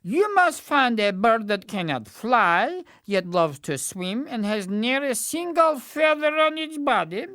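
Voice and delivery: pompous courtier faking a decree; scheming servant. Acted. Servant pretends to read task.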